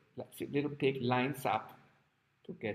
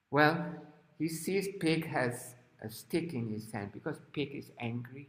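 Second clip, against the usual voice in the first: usual speaking voice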